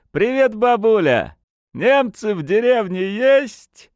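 high, loud tipsy call; high-pitched rowdy greeting; cheeky hail. A narrator's reading. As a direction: call out teasingly to someone over the fence. Tipsy lad hails old woman.

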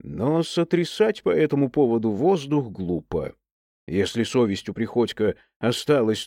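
plain reading voice

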